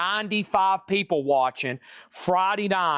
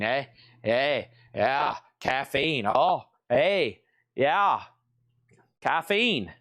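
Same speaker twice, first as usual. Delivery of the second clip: playful hint of Canadian and Boston accent; goofy drawn-out "eh" in mock Canadian-Boston accent; much slower than usual. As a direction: lightly put on a muddled Canadian-Boston accent. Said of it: "I don't know what accent I'm talking in".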